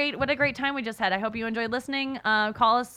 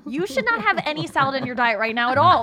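scolding; 4 semitones higher, 5 dB louder than usual. Retold or imitated. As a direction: lay down the law, half joking. Retold scolding of friend.

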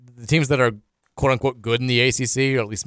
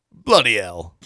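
lower-pitched, put-on Cockney accent; mock Cockney; 4 semitones lower, slower than usual. Host tries Cockney again to win over co-host, hammed-up accent. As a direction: toss out a jokey Cockney curse. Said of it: "talking in a Cockney accent".

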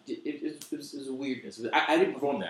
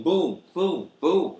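mock punch sounds; 4 semitones higher, 8 dB louder, much slower than usual. Sound effect of verbal beating.

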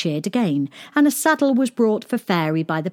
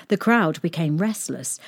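usual speaking voice

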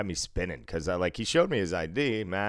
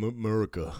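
mock 'Murica; 3 semitones higher than usual. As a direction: swallow the first syllable, mock-patriotic American accent. Jokey 'Murica said back and forth.

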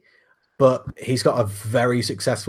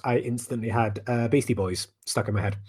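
ordinary talk, nothing marked

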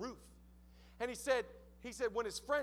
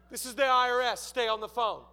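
loud, imposing government-agent voice; stern official caller; 6 dB louder than usual. Retold or imitated. IRS caller ordering him to stay on the phone, stern. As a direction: announce it like an intimidating tax official.

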